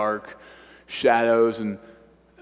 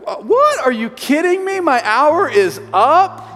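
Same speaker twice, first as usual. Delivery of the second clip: much higher, louder disbelieving cry, slowing on the last words; shocked outburst; 12 semitones higher, 9 dB louder than usual. Retold or imitated. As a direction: blurt it out in outraged disbelief. Retold shock at time up.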